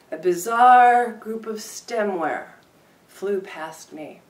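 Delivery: deliberate performance reading; poetry reading. Acted. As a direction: read it out like a poet at the mic. Poem reading continues.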